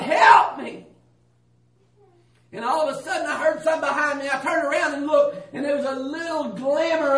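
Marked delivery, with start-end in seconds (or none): 0.0-0.9 retold plea, raised and louder; begging. Man lost in the darkness begging God, pleading cry. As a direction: cry for help, begging hard. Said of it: "I was begging God".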